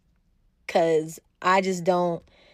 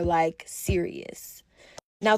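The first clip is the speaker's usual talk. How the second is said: normal talking voice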